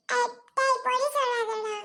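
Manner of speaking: put-on high, thin child voice; protesting little boy. Accused boy tells the other child not to lie, high protesting voice.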